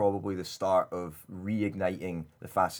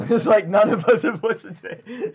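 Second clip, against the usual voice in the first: laughing through the words, higher and louder; 12 semitones higher, 10 dB louder than usual. Real, not acted. Host teasing co-hosts about unheard albums, laughing loudly. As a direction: crack up while talking. Host cracks up at own prediction.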